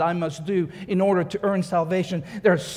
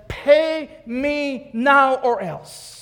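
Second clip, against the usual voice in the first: slow, higher threatening demand; menacing threat; 5 semitones higher, much slower than usual. Retold or imitated. Servant threatens the man who owes him, slow menacing demand. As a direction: lean in and spell out the threat slowly. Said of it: "grabs him by the throat and he says".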